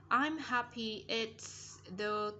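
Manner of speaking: very slow, word-by-word "I'm happy it's the"; careful model reading. Shown as a demonstration. Teacher guides pupils through song lyrics, slow careful model reading. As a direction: spell out each word for learners, slowly. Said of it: "hướng dẫn các bạn này đọc sao cho chuẩn âm".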